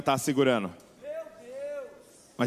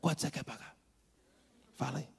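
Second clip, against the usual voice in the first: much softer, as if no one may hear the discount; low secretive voice; 10 dB quieter than usual. Retold or imitated. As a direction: murmur the deal conspiratorially. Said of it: "começa a falar baixinho". (baixinho, como quem conta um segredo)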